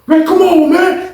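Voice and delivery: high, loud protest; voicing an inmate whining after a slap; pained protest. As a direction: complain after being hit. Zoe protesting after hit.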